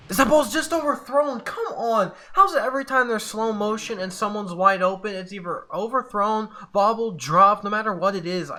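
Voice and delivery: high-pitched frustrated rant; exasperated complaint. Real, not acted. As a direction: start high and loud, then settle slightly as the gripe goes on. Complains about an overthrown ball.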